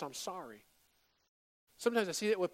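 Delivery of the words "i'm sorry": mumbled bare sorry. Retold or imitated. Bare dismissive apology.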